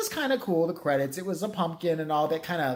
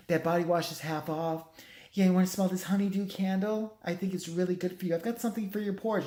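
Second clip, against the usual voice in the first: pushy salesgirl pitch, higher; teenage shop girl; 4 semitones higher than usual. Retold or imitated. Salesgirl pushes candles on a passing shopper, imitated chirpy pitch. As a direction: imitate a teen candle-store clerk pestering a customer. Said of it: "One of those little teenage girls".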